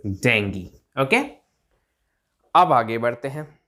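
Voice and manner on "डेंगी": lower, falling डेंगी ending in 'gee'; correct pronunciation. Shown as a demonstration. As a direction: model डेंगी as DENG-gee. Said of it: "इसकी correct pronunciation है". (नीचे सुर में 'डेंगी')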